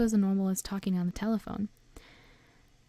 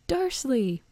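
raised, higher-pitched angry call; explosive summons; booming call; 6 semitones higher, 4 dB louder than usual. A narrator's reading. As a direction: boom the name out in fury. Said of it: "he boomed".

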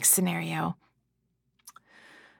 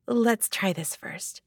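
usual speaking voice

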